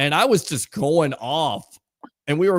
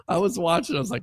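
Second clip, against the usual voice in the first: ordinary delivery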